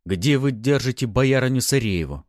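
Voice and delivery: a touch of menace, pushed out hard; growled demand. A narrator's reading. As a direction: growl the question at a captive. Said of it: "рыкнул на него Фёдор".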